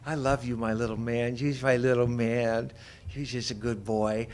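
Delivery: a touch of tender talk to the little dog; doggy talk. Retold or imitated. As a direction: coo gently to the dog. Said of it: "Teresa talking to the dog".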